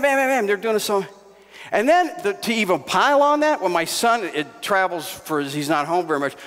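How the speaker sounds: ordinary talk, nothing marked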